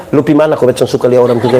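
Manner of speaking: quick, low sneering voice of a con man; lightly voiced swindler mocking the pastor. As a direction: rattle it off like a sly con man. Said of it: "tukang tipu datang dengan selom pendeta".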